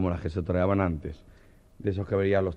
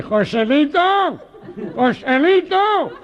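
much higher, louder drawn-out call for help; panicked cry; 10 dB louder, much slower than usual. Retold or imitated. The Meloja calling Joselito for help, a high stretched wail. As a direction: scream for rescue.

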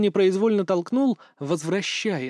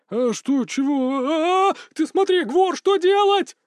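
rising frantic shriek, higher and higher; panicked yelling; 11 semitones higher, 4 dB louder, slower than usual. Acted. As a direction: yell in full panic. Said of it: "орал теперь ещё и Иркат". (панический визгливый крик)

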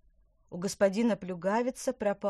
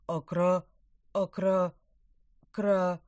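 stammering fish; 7 dB louder, slower than usual. Acted.

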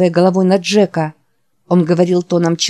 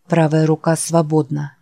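usual speaking voice

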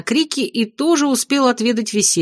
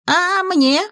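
much higher whiny imp wail; indignant wail; 11 semitones higher than usual. Acted. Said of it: "возопил хвостатый".

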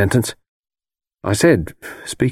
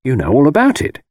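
mildly wheedling, higher pitch; 7 semitones higher, 3 dB louder than usual. A narrator's reading. Young man presses old woman for the story, wheedling. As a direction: coax with a light wheedle. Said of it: "I said, wheedlingly".

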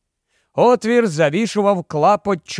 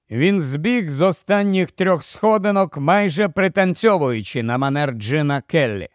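usual speaking voice

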